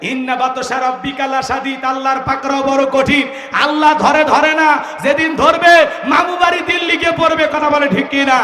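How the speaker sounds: loud, high-pitched sermon climax; forceful oratory building to a shout; thundering warning. Real, not acted. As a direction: preach the warning out to the crowd. Preaches at full force.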